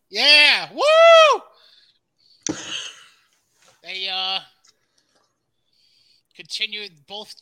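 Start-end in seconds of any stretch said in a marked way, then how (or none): 0.1-2.5 much higher, loud hype cheer; excited woo. Real, not acted. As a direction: whoop it like a wrestling fan. Hyping wrestling show opener.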